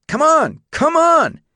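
frustrated yelling. A narrator's reading. Boy yells again at horse refusing paddock, frustrated shouting. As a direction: yank the words out in anger.

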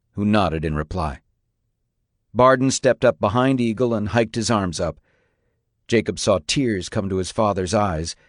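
usual speaking voice

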